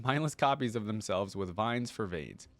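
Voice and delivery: ordinary delivery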